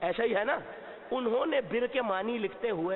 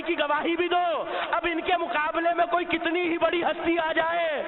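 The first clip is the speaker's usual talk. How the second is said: shouted sermon climax, much higher and louder; fiery pulpit cry; preaching at full force; 9 semitones higher, 4 dB louder than usual. Acted. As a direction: hammer the point home to the crowd. Fervent majlis oration.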